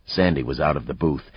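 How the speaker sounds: usual speaking voice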